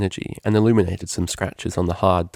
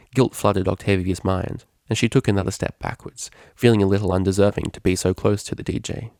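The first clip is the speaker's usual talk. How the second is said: ordinary delivery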